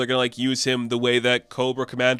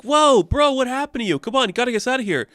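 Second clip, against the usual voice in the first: high, urgent cry to a hurt friend; retold panicky urging, quick at the end; mock alarm; 10 semitones higher, faster than usual. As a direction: hurry a fallen buddy along in a panic. Friend finds Yulktar shot.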